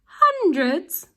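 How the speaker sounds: high, loud scoffing question; mock disbelief. Villain husband scoffs at the boy's claim, high. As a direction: scoff at the number. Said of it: "began Mister Glutton".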